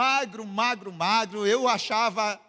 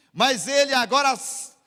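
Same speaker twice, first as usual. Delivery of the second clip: usual speaking voice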